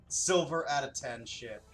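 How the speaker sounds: usual speaking voice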